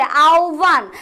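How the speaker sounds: "আহ্বান" as a long aau, then bhan; slow correct pronunciation. Shown as a demonstration. Teacher recaps the answers, "আহ্বান" stretched slowly into aau-bhan. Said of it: "আমি বললাম".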